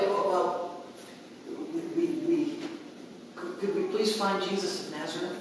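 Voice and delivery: soft, stammering, meek request; cowed centurion asking politely; timid second asking. Acted. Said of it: "little difference in the tone".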